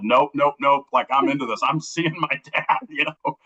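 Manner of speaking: high, eager nope nope nope breaking into laughter; excited defiance. Retold or imitated. Telling the host how he ignored the blizzard warnings, laughing and high-pitched. Defying warnings to see dad.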